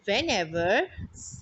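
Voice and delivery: usual speaking voice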